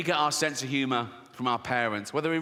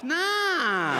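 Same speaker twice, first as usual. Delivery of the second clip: camp mock voice; 11 semitones higher, 3 dB louder than usual. Camp lad refuses.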